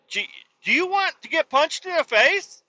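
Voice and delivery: high mock menacing voice; voicing Russia threatening. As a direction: play Russia daring a punch, hammed up. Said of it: "He's like".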